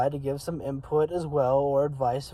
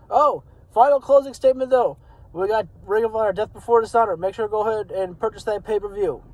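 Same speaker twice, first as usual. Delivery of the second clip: higher, louder burst of a last-minute plug; sudden upbeat afterthought, pitched up; excited announcement; 6 semitones higher, 7 dB louder, faster than usual. Real, not acted. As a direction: jump in loud and high with one last announcement. Sudden last plug before signing off.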